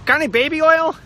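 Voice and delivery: high, loud youthful pleading; kid asking mom. Retold or imitated. Teenage son asking mom for baby oil, high and loud. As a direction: play his teenage self wheedling. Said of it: "used to be like".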